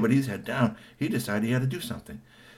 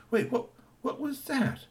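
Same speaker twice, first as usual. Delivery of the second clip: suspicious voice. Acted. Darius hears a noise.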